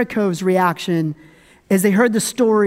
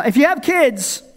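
ordinary talk, nothing marked

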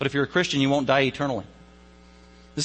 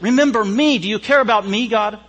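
raised, pleading voice of a dying thief; pleading cry; 6 semitones higher, 6 dB louder than usual. Acted. Preacher voicing the thief begging God, loud plea.

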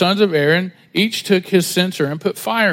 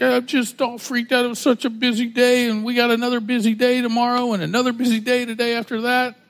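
wife quoted; 6 semitones higher than usual. Retold or imitated. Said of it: "she was like".

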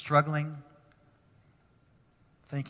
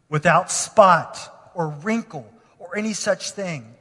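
scripture reading; 6 dB louder than usual. Acted. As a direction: read each clause as sacred words. Reads scripture aloud.

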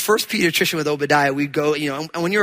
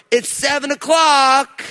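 slow, loud, higher wake-up call; mock child shout; 7 semitones higher, 3 dB louder, slower than usual. Little daughter waking her parents, shouting slowly. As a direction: announce the hour like a bossy little alarm clock. Child banging on door at seven.

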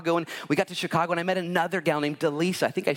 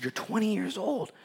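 higher, softer mock disbelief; acted-out incredulous repeat, pitched up; incredulous echo; 5 semitones higher, 5 dB quieter than usual. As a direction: repeat it back as if astonished. Repeats the age back in disbelief.

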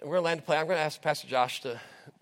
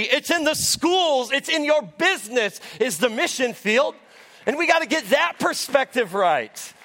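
rousing sermon delivery, rising to a shout; preaching; 7 semitones higher, 4 dB louder than usual. Acted. Pastor rallies the congregation, preaching with rising force. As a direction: preach it out to the whole room.

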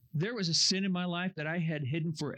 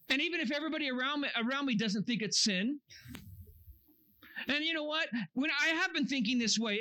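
self-justifying churchgoer; 7 semitones higher than usual. Retold or imitated. Mimicking churchgoer excusing their own sin.

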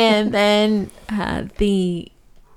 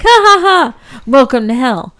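high, loud hammed-up demon laugh then welcome; mock demon cackle; 4 semitones higher, 6 dB louder than usual. Podcast host acting the film demon greeting a soul, hammed-up high cackle. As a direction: cackle like a gloating cartoon demon.

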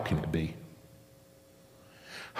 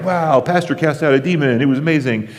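higher, louder gushing amazement; excited social media post; 7 semitones higher, 5 dB louder than usual. Retold or imitated. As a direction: rave about it like a thrilled churchgoer. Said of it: "we'd be like".

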